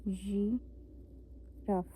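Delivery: very slow, soft, stretched-out "giraffe"; drawn-out French girafe. Shown as a demonstration. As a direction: stretch "giraffe" out slowly and softly. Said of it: "slow drawn-out model word".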